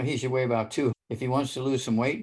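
normal talking voice

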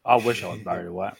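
low, rich crooner voice. Pretend. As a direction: drop the voice into a deep croon. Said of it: "Oh, with that voice".

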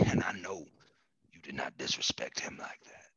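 wounded hushed whisper; Joe Biden whisper. Acted. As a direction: whisper it with quiet outrage.

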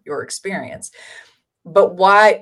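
ordinary talk, nothing marked

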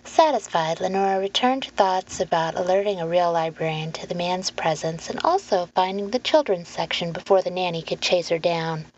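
ordinary delivery

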